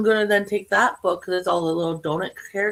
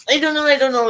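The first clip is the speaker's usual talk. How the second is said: higher, louder, I don't know twice; exasperated; 7 semitones higher, 6 dB louder, faster than usual. Real, not acted. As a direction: throw up your hands, flustered. Undecided, repeating it.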